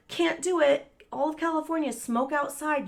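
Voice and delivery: high, timid, apologetic; cowardly polite to roommate; meek, nervous tone. Retold or imitated. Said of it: "a coward and didn't say anything".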